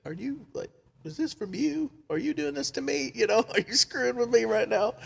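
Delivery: very high-pitched mimicry of disbelief; incredulous questioning. Friend asks if this is a prank, in high-pitched disbelief.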